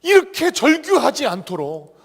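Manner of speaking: preaching at full force, high and loud; 열정적인 설교. Acted. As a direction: drive the point home to the congregation. Preaching climax.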